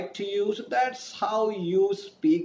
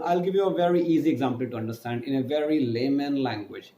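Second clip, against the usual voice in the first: ordinary delivery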